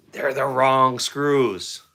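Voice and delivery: very slow, stretching each word out; exasperated punchline. Real, not acted. Customer revealing the second package is wrong too, drawn-out exasperation. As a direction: drag it out as the deadpan punchline.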